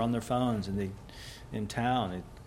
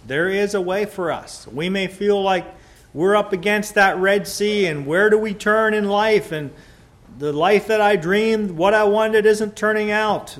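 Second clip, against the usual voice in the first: loud, high preaching at full force; rising pulpit fervour; 7 semitones higher, 9 dB louder than usual. Acted. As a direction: preach it out to the congregation. Preacher builds the Red Sea.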